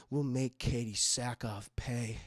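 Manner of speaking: low, soft menacing murmur; psychopath. Retold or imitated. Man plotting revenge in the mirror, quiet psychopathic menace. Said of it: "this guy's a fucking psychopath".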